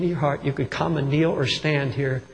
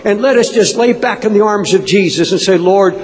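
raised, pleading prayer voice; fervent prayer; 5 semitones higher, 8 dB louder than usual. Real, not acted. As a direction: plead urgently with the Lord, pitched high. Impassioned prayer for comfort.

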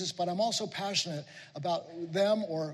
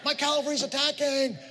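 put-on excited kid battle cry; 7 semitones higher than usual. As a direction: shout it like a thrilled little boy charging his toy cavalry. Boy playing toy soldiers with father.

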